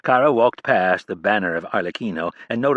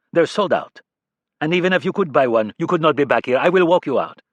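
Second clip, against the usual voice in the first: put-on cold French-accented assistant, louder and higher; thick French accent; 7 semitones higher, 5 dB louder than usual. A narrator's reading. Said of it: "in his thick accent".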